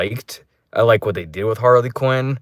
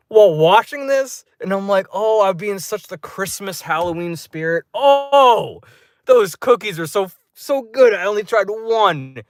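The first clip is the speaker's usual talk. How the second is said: high, worked-up mock lament; high-pitched dramatic yearning; anguished cookie longing; 9 semitones higher than usual. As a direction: wail over the lost treat, hammed up. Acts out longing for lost cookies.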